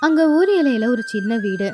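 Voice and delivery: plain reading voice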